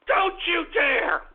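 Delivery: ranting shout at the listeners; yelling. Acted. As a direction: scold the trolls at full volume. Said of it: "I'm yelling at you pricks".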